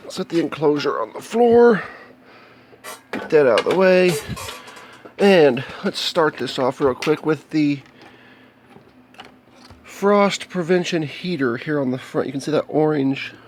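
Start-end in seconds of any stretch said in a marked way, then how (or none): none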